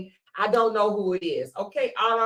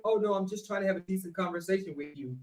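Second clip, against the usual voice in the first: soft, quick fake-polite tone; mock sweet reasonable voice; mock calm voice; 3 semitones lower, 10 dB quieter, faster than usual. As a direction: play the innocent, softly. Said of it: "he gets out of character and be like".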